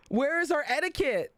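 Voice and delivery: ordinary talk, nothing marked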